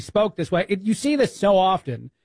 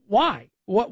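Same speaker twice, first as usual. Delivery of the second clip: ordinary talk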